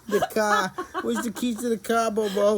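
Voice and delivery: mimicking a New Yorker, laughing through it; a hint of New York accent; mock New York accent. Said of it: "That is not New York".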